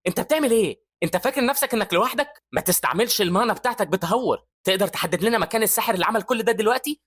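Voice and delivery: high-pitched exasperated telling-off; urgent scolding. Acted. Evie to Andreas over reckless mana use, scolding.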